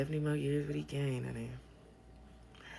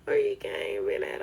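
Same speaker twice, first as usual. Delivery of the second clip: mock gossip; 4 dB louder than usual. Mocking viewers' gossip.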